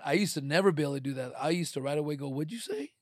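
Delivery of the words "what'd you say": confrontational challenge. Retold or imitated. Old confrontational self.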